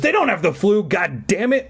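high, urgent insisting; insistent. Acted. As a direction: push back hard. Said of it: "I insisted".

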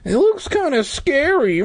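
high, whiny wimp voice; mock timid boy. A narrator's reading. Timid boy doubting the park, mock whiny. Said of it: "Clay said softly like a bitch".

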